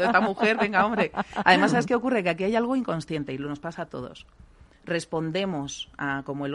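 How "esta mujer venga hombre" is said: louder and higher, fed up and dismissive; exasperated buyer. Pretend. A bored customer brushing off a dull saleswoman, loud and impatient. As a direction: roll your eyes at the dull saleswoman out loud.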